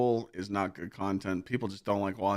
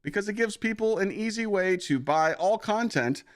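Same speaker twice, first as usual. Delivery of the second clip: higher, bouncy pitchman voice; mock ad-read voice; 8 semitones higher, 3 dB louder than usual. Streamer hamming up a G2A sponsor read, higher pitchman voice. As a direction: hype it up like a cheesy commercial. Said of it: "I'm just talking like this".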